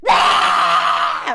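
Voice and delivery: long, loud, low-pitched scream; defiant drawn-out "aah" meant to annoy; deliberate yell. Shown as a demonstration. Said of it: "I'm gonna yell more".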